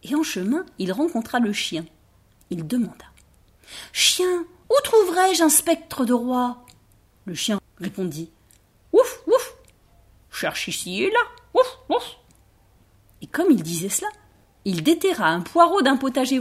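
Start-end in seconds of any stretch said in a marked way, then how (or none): 3.9-6.6 high, eager little rabbit voice; a small rabbit asking hopefully. Acted. Rabbit asks the dog. 8.9-12.2 high yapping woofs round the words; a little dog barking its answer. Acted.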